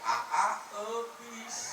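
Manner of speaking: "eupis" lisped, squeaky and high; baby-talk lisp on "eupis"; lisping bride. Acted. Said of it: "Ngomongna teh cadel". ("eupis" diucapkeun cadel, nyaring)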